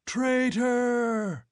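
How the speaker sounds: high, furious howled accusation. A narrator's reading. Angry tribesmen howling up at Conan. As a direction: hurl it like an accusation. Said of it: "The howl".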